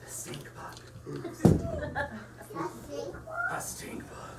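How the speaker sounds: hushed dramatic whisper. A narrator's reading. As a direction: breathe it like a secret reveal. Dramatic reveal of the villain.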